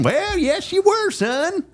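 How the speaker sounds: very high, slow country cop voice; Roscoe the cop. Retold or imitated. Texas cop greeting the speeding driver, in a very high voice. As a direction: squeak it out like an old small-town sheriff. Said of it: "He sounded just like that".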